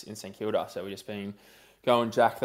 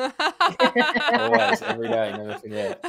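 laughing; 14 semitones higher, 6 dB louder, slower than usual. Real, not acted. Laughing reverent joke about hero.

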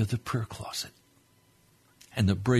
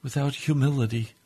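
fervent prayer; 2 semitones higher, 3 dB louder than usual. Real, not acted. Preacher praying to the Lord for his listeners, fervent prayer. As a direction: pray earnestly, hushed then rising to a fervent cry.

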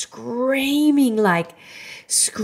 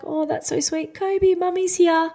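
cooing mum voice; 14 semitones higher than usual. Retold or imitated. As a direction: coo at the baby, sweet and high. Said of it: "and I was like".

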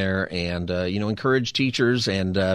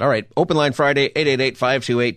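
upbeat host plug, louder; radio announcer; 5 semitones higher, 5 dB louder than usual. Acted. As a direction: punch the promo like a DJ. Radio host promo read.

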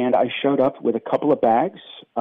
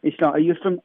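higher-pitched English RP demonstration; put-on British accent, pitched up; 3 semitones higher than usual. Shown as a demonstration. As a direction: show off a British received-pronunciation accent. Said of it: "What do you suppose you'd sound like right now if you had grown up in England".

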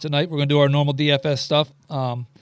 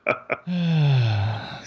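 long exasperated sigh; withering sigh; 2 semitones lower than usual. Real, not acted. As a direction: heave a weary, fed-up sigh. Exasperated about Urban Meyer.

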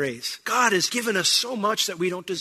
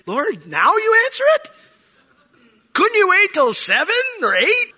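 high, loud, mock-exasperated whine at God; exasperated complaint; 7 dB louder than usual. A believer woken at two AM, mock-exasperated at God. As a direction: play up the annoyance at being woken.

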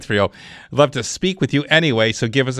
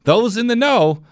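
upbeat radio ad read, pitched up; hard sell ad; 4 semitones higher than usual. Acted. Live read of a sponsor ad.

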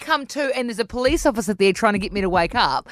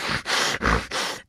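imitated menacing heavy breaths; heavy breathing; 5 dB quieter than usual. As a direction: huff hard, nose to nose. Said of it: "there's, like, heavy breathing".

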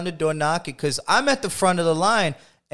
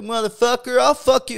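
high, loud furious threats; belligerent drunk; 9 semitones higher, 4 dB louder than usual. Retold or imitated. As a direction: shout it like a drunk picking a fight. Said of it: "He's like".

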